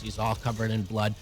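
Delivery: usual speaking voice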